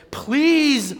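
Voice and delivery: high, loud, drawn-out exasperation; exasperated plea. Acted. Prophet praying crossly to the Lord, exasperated. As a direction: stretch it out high, fed up. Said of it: "Not, "Please, Lord, help me".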